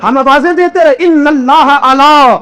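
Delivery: high, ringing sermon voice building up; impassioned preacher; preaching at full force. Acted. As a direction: preach it out, climbing with each line. Climactic retelling, rising preaching.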